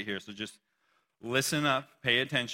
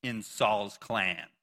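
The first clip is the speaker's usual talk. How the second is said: scripture reading; 5 dB louder than usual. Acted. Shimei's curse read on.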